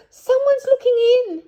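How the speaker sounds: very high, loud gasped cry; frightened cry. Acted. Goldilocks seeing a face at the window, frightened cry.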